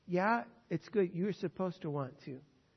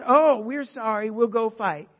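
higher, louder, slow whiny apology; mock sorry Israelites; 5 semitones higher, 15 dB louder, slower than usual. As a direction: ham up the people's hollow sorry. Mocking the repentant Israelites.

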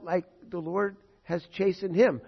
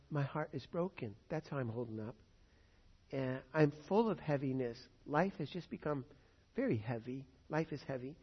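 subdued, softer acted sorrow; heavy-hearted; 7 dB quieter than usual. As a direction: let the voice sink under the burden. Voicing a heartbroken, burdened sufferer.

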